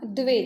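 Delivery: द्वे repeated, d and v blended; pronunciation demo. Shown as a demonstration. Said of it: "pronunciation सिर्फ याद रखना". (द्वे फिर से साफ़ उच्चारण)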